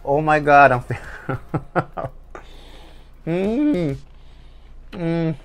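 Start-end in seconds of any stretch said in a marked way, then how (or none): none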